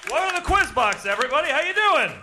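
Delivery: big high loud showman greeting; grand entrance announcement. Acted. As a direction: boom it out to the whole crowd. Host bursts on stage greeting crowd.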